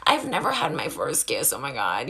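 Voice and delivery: low, soft mocking voice; pick-me mockery. Mocking guys who overuse an emoji, low pick-me voice. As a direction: mimic a pick-me guy, dropped low and quiet. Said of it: "such a pick me way".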